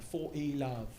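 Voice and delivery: put-on prestigious British accent; prestigious umpire accent. Umpire announcing the score to the players, prestigious fake British accent. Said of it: "like, prestigious accent".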